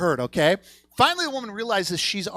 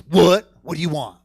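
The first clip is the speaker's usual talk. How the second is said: loud, abrupt "what" of a fed-up counter worker; acted brusque customer service; blunt service voice; 8 dB louder than usual. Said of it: "It's very blunt".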